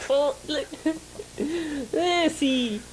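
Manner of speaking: high laughing squeals; breaking up with laughter; laughing hard. Real, not acted. Laughing fit.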